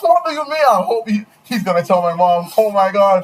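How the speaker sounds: loud, high, outraged disbelief; outraged rant. Real, not acted. Outraged by her threat.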